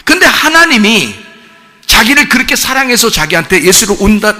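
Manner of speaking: impassioned pulpit delivery; fervent preaching. Acted. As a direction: preach it out to the congregation with rising fervor. Preacher builds to full force.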